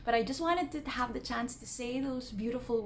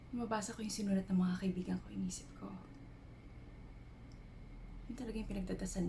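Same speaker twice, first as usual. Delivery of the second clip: soft, hushed introspection with long pauses; half-whispered, searching confession, left to hang between thoughts; reflective dramatic monologue; 10 dB quieter, much faster than usual. Acted. Woman reflecting on life's purpose while climbing a mountain at night, hushed monologue.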